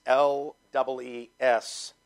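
ordinary delivery

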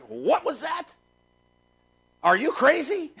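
high, rising, affronted questions; acted disbelief. Preacher acting out what that "what" means, incredulous. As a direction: challenge them as if they're out of their mind. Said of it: "Meaning like".

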